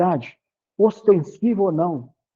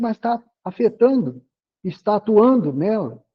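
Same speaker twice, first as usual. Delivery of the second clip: ordinary talk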